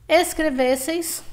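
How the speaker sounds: Escrevesseis slow and high, weight on ves; stressed penultimate syllable. Shown as a demonstration. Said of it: "paroxítona terminada em ei". (Escrevesseis devagar, com ves tônico e fechado)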